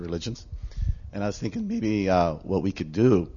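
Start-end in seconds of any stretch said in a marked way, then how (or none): none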